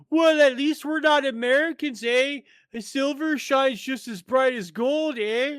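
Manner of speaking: high, loud mocking Canadian accent with eh. Mocking butthurt Canadians after losing, high loud Canadian accent. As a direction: sneer it like a sore Canadian fan in a Canadian accent. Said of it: "butthurt Canadians going".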